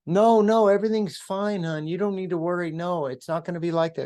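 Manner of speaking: insisting everything is fine while lying to his wife; fake reassurance. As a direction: reassure her too brightly to cover a lie. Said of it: "I lied".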